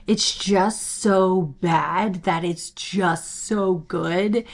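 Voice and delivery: drawn out slowly; slowed for effect. Real, not acted. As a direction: stretch it out. Savoring the phrase.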